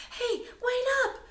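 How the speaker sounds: high shout down the street; yelling. A narrator's reading. Mother yelling after the scouts, high call. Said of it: "yelled the baby's mother".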